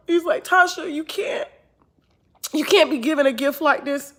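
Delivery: impassioned, near breaking; rising emotional outburst. Real, not acted.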